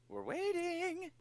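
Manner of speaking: high, drawn-out prompt; singsong waiting call. Pretend. Calling on a silent friend.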